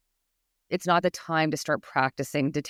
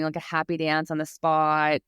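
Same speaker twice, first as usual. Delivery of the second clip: ordinary delivery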